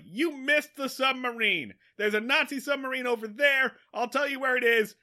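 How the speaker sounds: imitated shouted report; calling out. Fish calling into the sonar.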